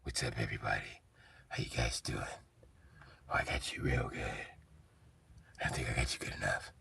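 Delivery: low, soft hushed Batman, half whispered; whispery Batman. Retold or imitated. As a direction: purr it out like a creepy hero. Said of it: "see Batman walk in like".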